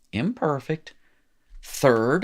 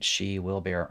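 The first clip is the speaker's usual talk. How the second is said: ordinary talk, nothing marked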